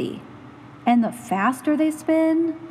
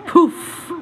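a playful poof as the worry vanishes; magic poof; 5 semitones higher, 5 dB louder than usual. Acted. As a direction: blow the worry away.

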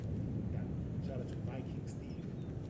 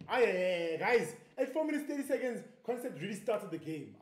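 higher-pitched, hyped-up call to the guys; excited announcement; 4 semitones higher than usual. Real, not acted. Streamer hyping viewers as Concept starts the game, excited announcement. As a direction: burst out with the big news.

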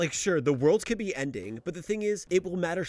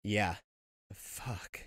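subdued reaction; 6 semitones lower, 7 dB quieter than usual. Real, not acted. Reacting after the tragic clip.

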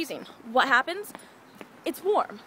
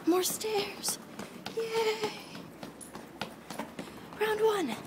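mock-cheery whisper, slow and dry; sarcastic whisper; 9 semitones higher, 5 dB quieter, much slower than usual. Out of breath on the stairs, sarcastic whispered yay.

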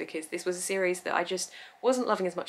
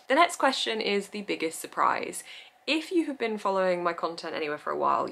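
usual speaking voice

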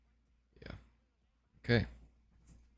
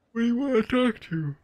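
high voice; 6 dB louder than usual. Acted. Reading the coach's dialogue in-game.

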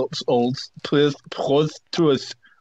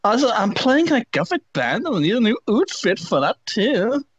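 put-on high whiny teen voice; whiny emo teen; 10 semitones higher than usual. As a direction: whine it like a goth teen. Reading fanfic quote.

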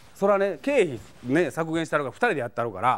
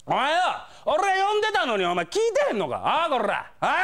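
yankee yelling; 10 semitones higher than usual. Retold or imitated. Delinquent yelling at a staffer at the used-book counter, furious. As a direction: bark it like an enraged delinquent. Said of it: "むっちゃキレてはるんです".

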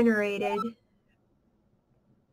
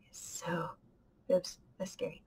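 breathy hushed whisper; 6 semitones lower, 12 dB quieter than usual. Real, not acted. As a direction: whisper it nervously. Scary spot in game.

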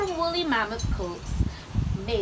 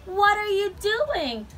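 high, slow question; curious raccoon; 9 semitones higher than usual. A narrator's reading. Raccoon asking grumpy Bird, curious and high.

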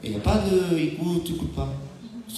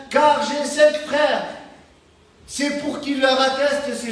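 raised, measured read-aloud gospel voice; solemn Bible reading from the pulpit; scripture reading; 8 semitones higher, slower than usual. Acted. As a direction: lift the voice and read the verse like scripture. Reads the gospel passage aloud.

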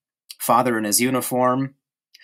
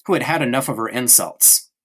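ordinary delivery

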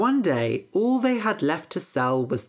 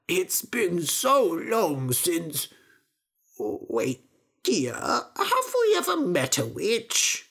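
put-on slow, muddled old father voice; doddering father; 2 semitones higher, slower than usual. Father asking his wife about witches, slow and muddled.

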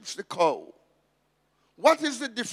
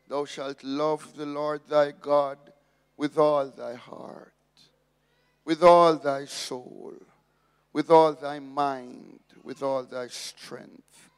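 solemn scripture recitation; 5 semitones lower, 4 dB louder than usual. Acted. Quoting the great commandment.

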